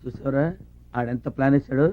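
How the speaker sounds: very low, soft undertone; hushed aside. Acted. Man confiding to his sidekick about the plot, hushed. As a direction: keep it secret, low and quiet. Secretly remarks on the officer's plot.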